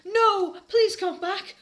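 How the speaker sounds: high, loud desperate pleading; hammed-up sad shout. Draco shouting after Ebony, high pleading wail. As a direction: beg her to come back, wailing. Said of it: "Shouted Draco sadly".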